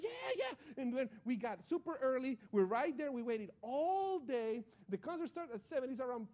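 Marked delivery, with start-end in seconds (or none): 0.0-0.8 high-pitched eager yeah yeah; excited. Retold or imitated. Telling his sister, a high thrilled yeah yeah. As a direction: gush like a thrilled teen.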